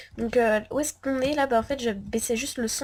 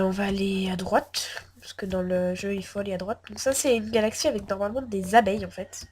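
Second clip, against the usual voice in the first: ordinary talk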